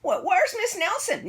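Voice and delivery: high, stammering question; timid kid. A narrator's reading. Scared child asking new teacher, high and timid. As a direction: ask nervously like a scared child.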